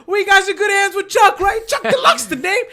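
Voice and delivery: a homeless man pitching himself as Chuck Deluxe, hammed-up high boast; imitating Chuck. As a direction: belt it like a street hustler selling himself. Chuck boasting his own name.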